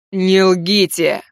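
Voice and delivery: booming thunder and wind. Acted. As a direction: boom it out slowly. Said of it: "прогремел голос царя".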